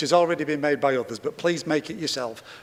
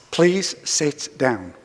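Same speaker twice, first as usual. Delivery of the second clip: "please sit down" drawn out word by word; slow, deliberate order; much slower than usual. Real, not acted. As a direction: slowly insist on "please sit down". Chair repeats order to defiant councillor.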